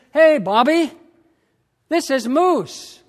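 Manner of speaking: a hint of a hearty old buddy on the phone; buddy's greeting. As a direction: call out like a cheerful old pal. Friend's voice on phone retold.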